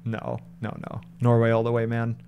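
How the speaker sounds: usual speaking voice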